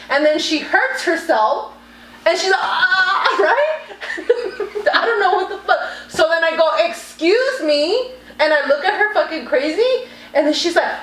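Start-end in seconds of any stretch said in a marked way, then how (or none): 2.6-3.4 high, drawn-out imitated "ah" of pain; mock cry of pain. Teller imitating the woman who hurt herself kicking, mock pained cry. 6.9-8.1 drawn-out, higher mock-indignant challenge. Teller confronting the woman kicking the car, retold indignation.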